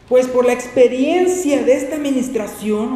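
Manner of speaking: loud, high, slow proclamation; scripture reading. Acted. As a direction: proclaim the verse to the congregation. Reads scripture aloud at full force.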